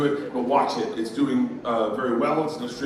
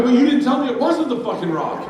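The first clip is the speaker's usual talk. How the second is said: louder, laughing protest; exasperated retort; 11 semitones higher, 6 dB louder than usual. Retold or imitated. Arguing with the Disney guy, exasperated laughing protest. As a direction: push back in disbelief.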